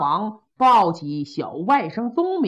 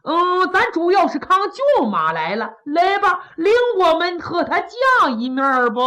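put-on higher, quick, chummy old minister's voice; a breezy old official pushing to see the coffin, pitched up; higher, hearty old official; Kou Zhun, jovial; 8 semitones higher, faster than usual. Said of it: "寇准说".

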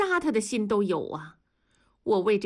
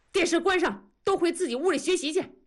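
furious roar; 9 semitones higher, faster than usual. Acted. Angry father bursting in to stop the kids watching TV, roaring. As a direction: roar the order like an enraged father. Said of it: "大吼了一声".

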